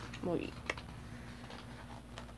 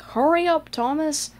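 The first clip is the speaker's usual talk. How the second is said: higher, louder bossy call; impatient Gordon; 6 semitones higher, 4 dB louder than usual. Retold or imitated. Gordon the big engine urging Thomas, bossy call. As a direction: hurry him along. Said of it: "and Gordon like".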